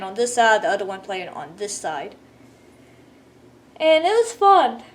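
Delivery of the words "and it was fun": words stretched by a yawn. Real, not acted. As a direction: talk through a yawn. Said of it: "Please forgive me for yawning".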